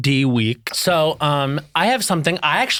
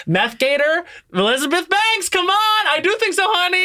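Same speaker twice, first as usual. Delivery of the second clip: rising, pleading much higher to a squeal; excited pleading; 13 semitones higher than usual. Acted. Host pleads with Elizabeth Banks to make Meth Gator, much higher excited plea. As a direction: beg the director with mounting excitement.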